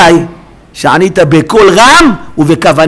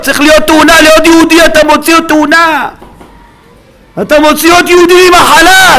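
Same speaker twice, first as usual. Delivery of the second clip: high, shouted exhortation; full-force preaching; 12 semitones higher, 4 dB louder than usual. Real, not acted. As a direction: thunder at the congregation, urging belief. (דרשה בצעקות)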